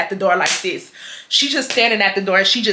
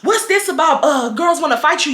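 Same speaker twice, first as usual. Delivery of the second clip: mocking the boyfriend; 7 semitones higher than usual. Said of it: "and he was like".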